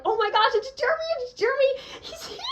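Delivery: high, fast excited squeal; breathless fangirl squeal; squealing excitedly. Retold or imitated. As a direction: shriek like a starstruck fan. Excited fan spotting idol.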